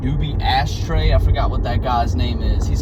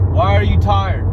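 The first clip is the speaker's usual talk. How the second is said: higher, louder nagging "Why are you tired?"; mock him pestering, pitched up; imitating his brother; 8 semitones higher, 6 dB louder than usual. Brother nagging why he is tired, higher and louder. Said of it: "He'll be like".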